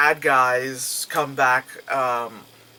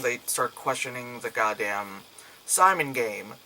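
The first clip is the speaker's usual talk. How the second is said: ordinary delivery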